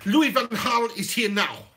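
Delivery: put-on slow, deliberate Dutch accent; hammed-up Dutch accent; Louis van Gaal. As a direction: announce yourself as a comic Dutchman. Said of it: "do an L V G impression".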